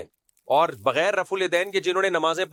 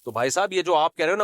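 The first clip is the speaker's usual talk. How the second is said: ordinary delivery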